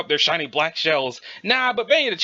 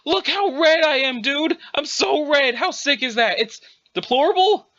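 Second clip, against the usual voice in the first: higher, breathless bragging; giddy excitement; 9 semitones higher than usual. Acted. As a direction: marvel at yourself like a kid. Muscle character excited about turning red.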